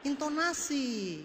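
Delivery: "Intonasi" slow, high and soft; drawn-out word. Shown as a demonstration. Speaker teaching audience the key word, "Intonasi" drawn out. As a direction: stretch "Intonasi" out slowly, lifted and soft.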